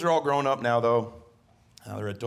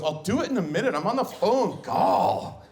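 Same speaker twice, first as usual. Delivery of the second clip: put-on higher, louder exasperated teen son; annoyed teen; 9 semitones higher, 5 dB louder than usual. Son on the phone dismissing dad, annoyed whine. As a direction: brush dad off impatiently. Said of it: "And he goes".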